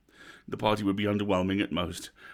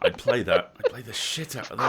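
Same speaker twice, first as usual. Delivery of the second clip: laughing while saying it, amused; 2 semitones higher, 4 dB louder than usual. Real, not acted. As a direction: chuckle through the words, really tickled. Amused reaction to a silly game idea.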